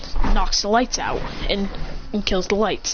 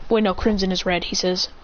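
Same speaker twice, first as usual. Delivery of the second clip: ordinary delivery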